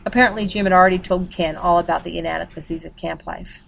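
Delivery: usual speaking voice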